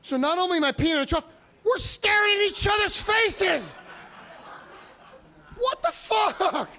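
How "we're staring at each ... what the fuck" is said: high, slow, incredulous wail; appalled disbelief. Acted. Comic telling the crowd about the urinal, high appalled disbelief. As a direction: let the outrage climb up high and stretch it out.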